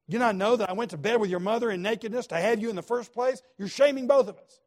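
building to a loud, high furious rant; angry father's tirade. Retold or imitated. Saul raging at Jonathan over his mother, furious. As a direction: pour your rage onto your son. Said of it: "he is some kind of angry".

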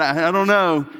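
halting, evasive "I don't know"; a cornered dad brushing it off; mock innocent stammer; evasive denial. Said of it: "they know when I'm angry".

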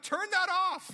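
high loud yell to kill the noise; annoyed shout. Retold or imitated. Father yelling at the blaring CD player, high and loud. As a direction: bark it over a blaring CD player. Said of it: "I'm like".

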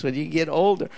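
ordinary talk, nothing marked

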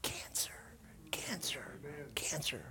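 whispered, dying away to nothing; the cancer voice whispering as it loses power; fading whisper. Retold or imitated. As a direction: let the word shrink to a whisper until it's gone. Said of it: "the voice in her mind was going".

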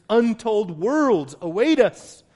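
slow, loud, soaring pulpit cry; preaching at full force. Acted. Preacher to his brothers and sisters, slow and loud. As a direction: lift it up to the congregation.

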